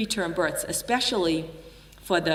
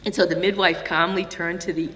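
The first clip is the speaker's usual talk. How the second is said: normal talking voice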